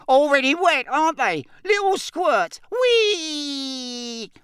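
high, gleeful poltergeist jeering; mischievous sprite shrieking with delight; cackling Peeves. Acted. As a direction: taunt them, ending in a long whee. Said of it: "cackled Peeves".

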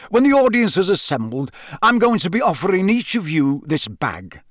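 high, lively briefing; bright Bagman. A narrator's reading. Bagman explaining the bag to champions, bright. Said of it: "said Bagman brightly".

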